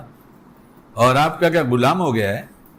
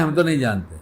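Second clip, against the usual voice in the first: ordinary talk, nothing marked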